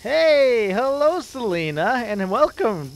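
loud, high, slow shout-out; excited shout-out. Real, not acted. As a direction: greet her like a big announcement. Greeting a viewer.

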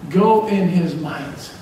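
"Go in his might." stretched slowly, each word weighted; slow, drawn out. Shown as a demonstration. Preacher repeats the sermon title to the church, slow and drawn out. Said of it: "repeats the theme phrase slowly".

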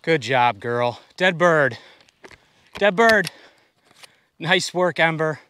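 high, loud happy praise; excited praise. Real, not acted. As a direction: cheer the dog on. Excited praise after dog retrieves bird.

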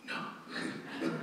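very soft, almost voiceless no; quiet retold refusal. Cessationist refusing the missionary's question, barely audible. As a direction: drop it to a near-whisper.

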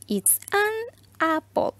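It's an apple, high and very slow; sing-song teacher model, stretched; high slow model answer. Shown as a demonstration. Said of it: "Entonces yo respondo". (respuesta modelo aguda y muy lenta)

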